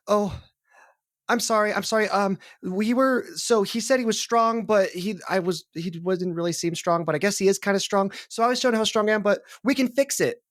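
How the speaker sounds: high-pitched, rambling, flustered excuses; wilting apology. Acted. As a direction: wilt as if called out, then offer to help. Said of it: "Fenn visibly wilts at being called out".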